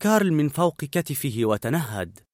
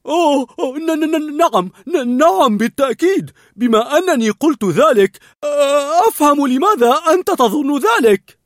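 high, loud, stuttering nervous dog; a scared dog stalling his boss; nervous stammer; 13 semitones higher, 9 dB louder than usual. A narrator's reading. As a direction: panicky stammer, much higher. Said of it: "فرد داغ بتوتر".

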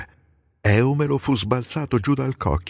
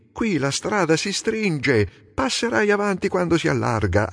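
alarmed shout; 10 semitones higher than usual. A narrator's reading. Menelao warning Antiloco on the narrow track, high alarmed shout. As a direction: warn the reckless driver, pitch way up. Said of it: "gridò ad Antiloco".

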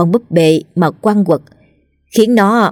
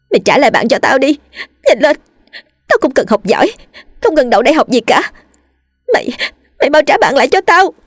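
high, frantic angry yelling; screaming demand; 8 semitones higher than usual. A narrator's reading. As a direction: demand it back in a high, desperate cry. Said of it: "hét lên". (hét lên đòi trả bạn)

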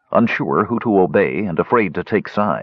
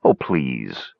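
lower, dismissive amusement; mildly amused; 6 semitones lower than usual. A narrator's reading. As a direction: brush it off with amusement. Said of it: "Pitrick sounded mildly amused".

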